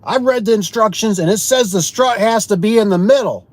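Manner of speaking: a hammed-up indignant homeowner reading the manual; mimicking a livid customer. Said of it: "And he's, he's livid".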